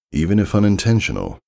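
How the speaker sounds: plain reading voice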